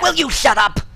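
loud, exasperated yell; angry shout. Acted. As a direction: yell at him to stop. An acted quarrel.

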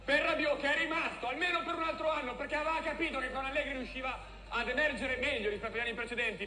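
normal talking voice

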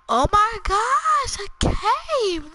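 high, drawn-out gasp of surprise; amazed discovery. Real, not acted. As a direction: cry out slowly, pitch way up. Startled discovery of a cave.

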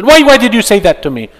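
high, loud indignant complaint; offended outburst. Retold or imitated. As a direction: snap back as if deeply insulted. Voicing an offended person.

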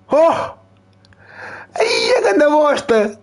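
stretched-out cry of disgust; dismayed outcry. Real, not acted. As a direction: wail at the bad luck. Bad card rating revealed.

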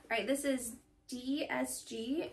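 normal talking voice

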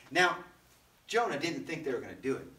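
normal talking voice

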